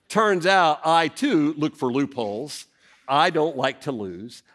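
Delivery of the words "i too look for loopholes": ordinary talk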